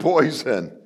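usual speaking voice

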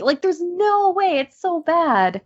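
high, slow, exasperated. Real, not acted. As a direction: groan at how bad it is.

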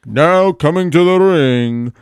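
loud, drawn-out wrestling ring announcer. Pretend.

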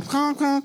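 high honking noise; air horn honk. Pretend. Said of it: "I was trying to do the air horn".

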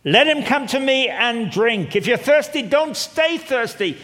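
high, loud, slow cry of invitation; loud proclaiming call. Acted. Jesus at the feast crying out, high and loud. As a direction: call out to the thirsty crowd. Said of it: "said in a loud voice".

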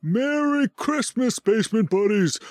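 high, jolly Santa laugh. Acted. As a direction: belt a jolly Santa greeting, slow. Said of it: "from Santa Claus".

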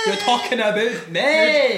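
Scottish accent. Shown as a demonstration. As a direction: drag it out in a broad Scottish accent. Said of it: "No, it's Scottish".

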